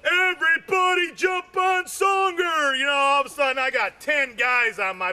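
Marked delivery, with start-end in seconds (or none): 0.0-2.8 a mock sergeant's yell, higher. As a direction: call out to the troops like a rowdy sergeant. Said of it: "He yells out".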